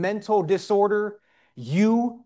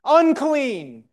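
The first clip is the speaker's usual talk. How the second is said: high, drawn-out crier's call; retold shout; 8 semitones higher, slower than usual. Preacher imitating people shouting at a leper, high retold shout. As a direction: imitate a street crowd's cry, pitched well up and falling. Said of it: "people would shout".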